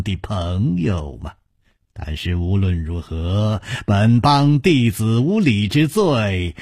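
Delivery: slow, courtly, drawn-out speech; genial gang leader. Acted. Nangong apologises for his disciples, slow genial courtesy. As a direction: speak slowly with courteous dignity. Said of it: "南宫绫笑着说".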